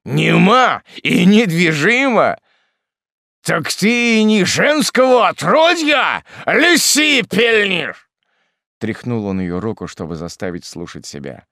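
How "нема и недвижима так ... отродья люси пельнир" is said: high, loud, slow shouting; savage, booming reproach; raging scorn. Acted. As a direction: bellow at her, stretching every word. Hermit goes on raging.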